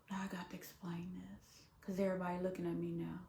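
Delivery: fast hushed whisper; whispered confiding aside. Real, not acted. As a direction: mutter it under your breath.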